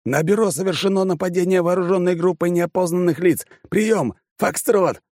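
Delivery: high urgent shout over gunfire; shouting into radio. Acted. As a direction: bark the report into the radio.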